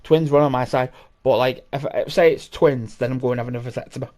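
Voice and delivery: ordinary delivery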